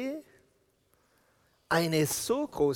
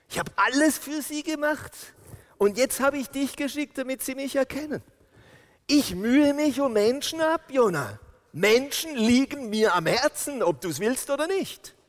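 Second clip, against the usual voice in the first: higher, louder plea in God's voice; impassioned preaching; 9 semitones higher, 3 dB louder than usual. Acted. Preacher voicing God's plea to Jona, impassioned. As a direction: preach it as God pleading for people.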